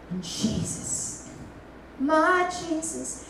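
slow hushed whisper; reverent whisper. Real, not acted. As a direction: breathe the name softly.

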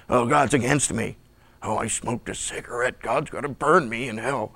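low, quiet, doom-laden moping; mock gloom. Religious person fearing hell over a cigarette, mock gloomy. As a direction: sink low and soft, imitating a guilty worrier.

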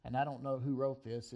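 normal talking voice

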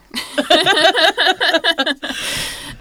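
high laughing burst; burst of laughter. Real, not acted. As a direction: laugh out loud. Laughing at own joke.

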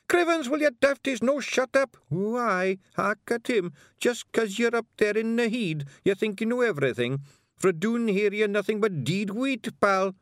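angry little Scottish pixie; cussing Scottish pixie. Acted. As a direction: grumble in broad Scots as a tiny cross pixie. Third Feegle scolds the head.

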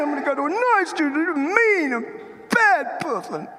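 high, whiny mock complaint from an offended churchgoer. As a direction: parody the offended listener, squeaky and put-upon. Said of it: "some of you are like".

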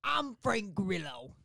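slow, hammed-up Boston accent. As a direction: drawl it in a thick Boston accent. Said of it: "Boston accent".

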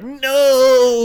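high, loud dramatic no; mock shout. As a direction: yell like a horrified TV lady. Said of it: "the fucking lady on the screen's like".

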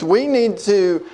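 ordinary delivery

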